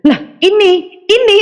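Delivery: sudden shrill gasp of recognition; surprised cry. Retold or imitated. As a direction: cry out in shock at the screen. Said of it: "saya teriak di situ".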